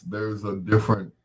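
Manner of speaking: ordinary talk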